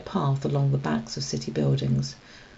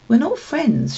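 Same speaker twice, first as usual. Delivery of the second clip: snapped; 8 semitones higher, 4 dB louder than usual. A narrator's reading. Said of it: "she snapped".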